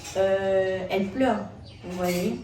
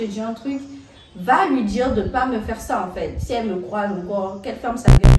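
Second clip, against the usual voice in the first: retold angry threat; 2 semitones higher, 10 dB louder than usual.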